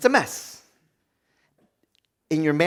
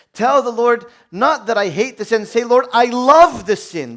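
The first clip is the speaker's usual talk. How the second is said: forceful preaching; 8 semitones higher, 8 dB louder than usual. Acted.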